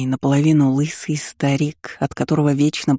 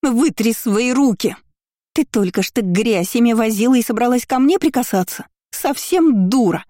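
furious scolding; 7 semitones higher than usual. Acted. Kiora berates her cousin for dirty hands, higher and furious.

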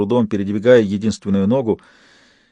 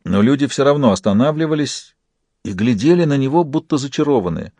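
usual speaking voice

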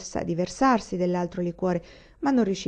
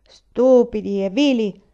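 contemptuous; 4 semitones higher, 8 dB louder, slower than usual. Acted. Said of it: "continuò Bungi".

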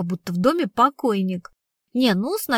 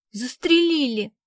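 tearful; 4 semitones higher than usual. Acted. Girl tearfully tells of killing.

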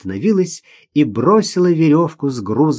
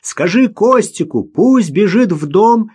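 raised, louder voice carrying over distance; shouted instruction; 8 semitones higher, 5 dB louder than usual. A narrator's reading. Rostovtsev sends word to run to the house, shouting. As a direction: call out urgently, high and drawn out at the end. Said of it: "крикнул Ростовцев".

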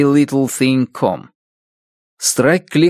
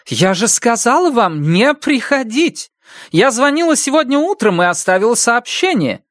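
angry; 11 semitones higher than usual. Acted. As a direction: scold him sharply. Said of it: "сердито произнесла". (сердито, с упрёком)

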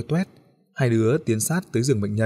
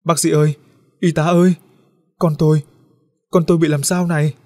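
frantic mother shouting for help, much higher and louder; panicked cry; 5 semitones higher, 7 dB louder than usual. A narrator's reading. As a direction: scream for the doctor in panic. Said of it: "hoảng hốt la lên".

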